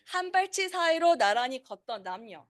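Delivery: brisk, native Korean reading of "한 발치 사이로 나란히 걷던 남녀"; Korean poem read aloud. Shown as a demonstration. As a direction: read "한 발치 사이로 나란히 걷던 남녀" quickly as a fluent Korean speaker would. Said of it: "So literally, it means".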